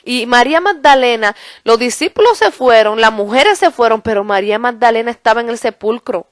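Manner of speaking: ordinary talk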